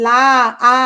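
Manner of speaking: লা, আ with the আ held long; full vowel. Shown as a demonstration. Teacher demonstrating a full vowel, লা, আ held long. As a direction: draw out the open আ in লা, আ. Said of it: "এটা হচ্ছে পূর্ণ স্বরধ্বনি".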